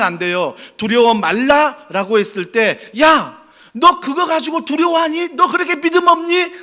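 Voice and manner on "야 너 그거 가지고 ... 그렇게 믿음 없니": high, loud scolding mock rebuke. Shown as a demonstration. Pastor mocking a harsh God scolding the fearful, loud rebuke. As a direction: scold a faithless child, hammed-up. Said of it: "이렇게 말씀하신 게 아니에요".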